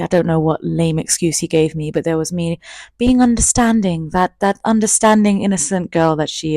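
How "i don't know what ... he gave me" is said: ordinary talk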